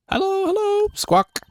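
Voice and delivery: very high, piercing parrot squawk. Acted. Pet parrot greeting visitors, very high squawky voice. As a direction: squeak it like a talking bird.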